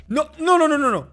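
high, loud string of refusals; protesting shout. Real, not acted. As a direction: yell it in alarm, easing off slightly. Reacts to losing in the game.

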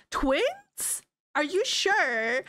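stunned disbelief. Retold or imitated. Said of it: "He's like".

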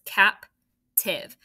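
"captive" in two separated beats, cap, then tiv; split into syllables. Shown as a demonstration. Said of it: "it's two syllables".

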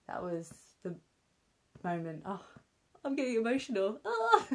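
tearful half-laugh, voice catching; choked up while laughing it off; getting emotional. Real, not acted. Mum recalls her partner seeing their baby, tearful laugh. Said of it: "I get emotional now".

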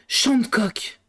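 astonished exclamation of a name; mildly staggered cry; stunned. A narrator's reading. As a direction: blurt it out in amazement. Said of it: "sidéré".

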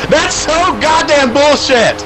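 high, slow, loud outburst; angry yell. Real, not acted. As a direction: rage at the game. Frustrated after conceding.

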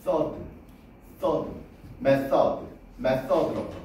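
method의 th를 s와 t 사이 소리로; method, 혀 내밀었다 당기는 th로 낮게; 번데기 th 발음. Shown as a demonstration. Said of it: "그 가운데 발음이야". (method의 '써드'를 th로)

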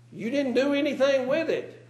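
high, loud mock servant and master; preacher voicing the one talent man and his Lord; mock servant then master.

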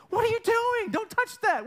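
high, loud mock-panicked mother; scolding mom. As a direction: cry out like a mom stopping a child. Mother stops child touching cake.

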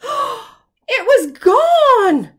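gasp, then very slow, high, loud dismay; gasping shock. A narrator's reading. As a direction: stretch out the shock after a gasp. Suspense reveal with gasp.

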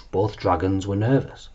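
plain reading voice

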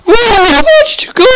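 mock tearful lover. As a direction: wail after a lost love, hammed up. Playing distraught lover character.

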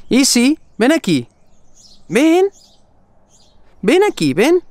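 high, loud call to the dog; sing-song high call to the dog. Real, not acted. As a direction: call her over from afar. Calling the dog.

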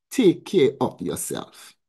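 usual speaking voice

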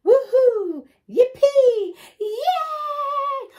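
high, loud cheering; screaming fans. A narrator's reading. Fans screaming for the reindeer, high loud cheers. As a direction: cheer like a crazed fan. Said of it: "all screaming".

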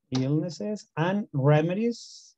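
"Illnesses and remedies" said slowly with a rise; slow classroom modelling; slow teacher model. Shown as a demonstration. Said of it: "which in Spanish means remedios".

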